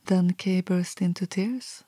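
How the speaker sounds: plain reading voice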